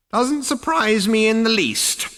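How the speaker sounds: a creaky ancestor drawing out the name. A narrator's reading. Ghost ancestor speaks.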